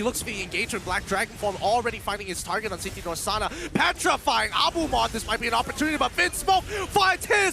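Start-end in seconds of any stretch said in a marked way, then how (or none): 3.7-7.5 hyped play-by-play, higher and louder; esports caster. Acted. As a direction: build the excitement as the teamfight breaks.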